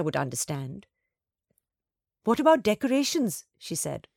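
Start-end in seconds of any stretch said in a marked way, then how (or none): 2.2-3.4 higher, brighter rising interest; getting excited. Acted. Girl asks her mother about decorations, getting excited. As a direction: perk up as the idea takes hold. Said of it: "beginning to get excited".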